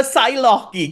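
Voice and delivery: "Psylocke" with an extra "ee" on the end; mispronounced Psylocke. Shown as a demonstration. Explaining a childhood misreading, "Psylocke" mispronounced with a final "ee". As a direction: say "Psylocke" as "sigh-LOCK-ee". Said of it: "just Psylocke. Like, I didn't know how you pronounced it".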